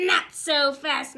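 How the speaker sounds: strict coach catching a sneak; stern command. Acted. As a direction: halt the runaway player. Coach Swamp stops the fullback.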